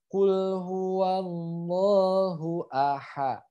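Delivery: slow held recitation with a smooth H in "aha"; soft H. Shown as a demonstration. Teacher demonstrates the letter H, slow recitation, soft H. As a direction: draw out "huwallahu", soft airy H on "aha". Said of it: "Jadi halus ya, hanya jadi H".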